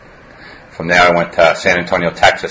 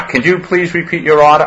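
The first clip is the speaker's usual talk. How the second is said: drive-thru voice; 11 semitones higher than usual. Retold or imitated. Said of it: "it'll say".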